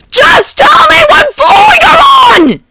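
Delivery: high, slow, loud desperate roar. Acted. Canary roaring at Bakugo for an answer, frantic. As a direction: bellow it, near tears. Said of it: "Canary roared".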